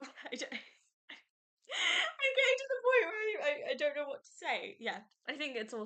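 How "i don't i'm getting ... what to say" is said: high, tearful laugh-cry breaking through; choked-up crying. Real, not acted. Fan telling viewers about Percy and Annabeth, choking up in tears. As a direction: let the tears break the words, half laughing. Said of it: "I'm getting choked up again".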